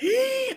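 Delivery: very high, short gasp; sharp squeaky intake of breath; shocked gasp. Real, not acted. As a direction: gasp in horror at the detail. Shocked at spitting story.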